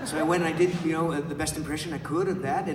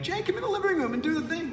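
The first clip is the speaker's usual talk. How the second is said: mock family member; 13 semitones higher than usual.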